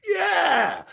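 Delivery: a high, eager Yeah; eager yes. Retold or imitated. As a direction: jump at the offer, voice leaping up. Eager yes to pro job offer.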